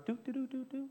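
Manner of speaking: soft, high little walking tune; light doo-doo-doo. Retold or imitated. Preacher miming Jesus walking on the water, light doo-doo-doo. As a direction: playfully hum tiptoeing steps in doo syllables.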